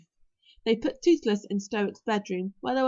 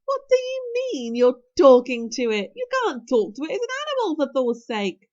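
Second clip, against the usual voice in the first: high-pitched, loud disbelief; astonished protest; 3 semitones higher, 7 dB louder than usual. A narrator's reading. Fishlegs scolding Hiccup over talking to an animal, astonished. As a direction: burst out incredulously, pitch leaping up.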